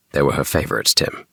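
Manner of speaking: usual speaking voice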